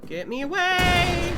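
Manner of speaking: high, loud, slow hammed-up plea; drawn-out mock-frightened cry; mock panic. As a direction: wail it like a cartoon victim.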